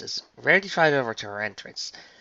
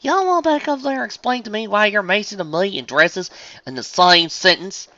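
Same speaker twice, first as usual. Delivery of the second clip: loud, higher country twang; baffled southern farm pony; 10 semitones higher, 7 dB louder than usual. Acted. Farmer asks friend to explain, baffled twang.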